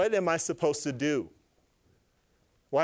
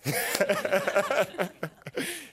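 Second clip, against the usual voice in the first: high laugh; 4 semitones higher than usual. Real, not acted. Laughs at own impression.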